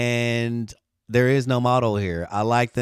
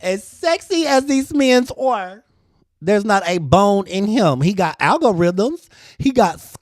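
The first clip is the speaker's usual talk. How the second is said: sassy rant; 7 semitones higher, 9 dB louder than usual. Acted. Teasing viewers about a handsome AI model, high sassy diva. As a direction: lay it on thick, honey.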